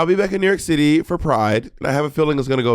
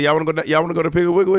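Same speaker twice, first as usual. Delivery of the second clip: quick, higher, easy Atlanta question; natural Atlanta accent; 4 semitones higher, faster than usual. Shown as a demonstration. Atlanta host showing how "we say" it, quick natural accent. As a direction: say it fast in unexaggerated Atlanta speech. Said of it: "We say".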